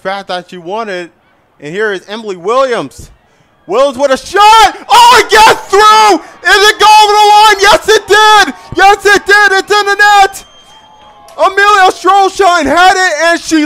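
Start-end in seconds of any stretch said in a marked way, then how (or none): 3.7-7.6 loud, high goal shout; excited goal call. Real, not acted. Goal scored, commentator erupts. 7.6-8.5 excited. Real, not acted. Goal confirmed. 8.8-9.5 excited. Real, not acted. Goal confirmed. 9.5-10.5 loud, high goal shout; excited goal call. Real, not acted. Goal scored, commentator erupts.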